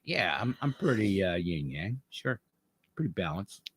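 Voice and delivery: dry muttered self-assessment; wry understatement. Retold or imitated. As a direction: shrug it off quietly to yourself. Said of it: "was like".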